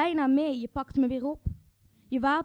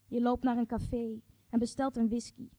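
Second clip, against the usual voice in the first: plain reading voice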